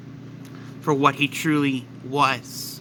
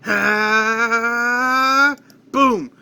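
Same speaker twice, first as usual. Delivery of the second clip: powering-up yell; 6 semitones higher, 4 dB louder than usual. Acted. Vegeta powering up, then exploding.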